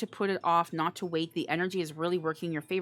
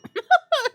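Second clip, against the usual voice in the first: loud high laugh; 11 dB louder than usual. Real, not acted. Reader laughing loudly at the viewer's imagined panic.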